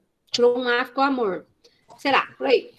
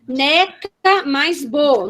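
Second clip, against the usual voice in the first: slow, drawn-out NECA mais BO; very slow teaching delivery; syllable demonstration; much slower than usual. Shown as a demonstration. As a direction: dictate the pieces unhurriedly. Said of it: "manipulação silábica".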